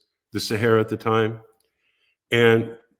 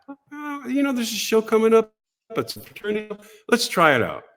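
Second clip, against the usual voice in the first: much higher Gary voice; imitating Gary; 11 semitones higher than usual. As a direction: rattle it off like a busy producer. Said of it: "He goes".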